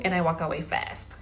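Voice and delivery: ordinary talk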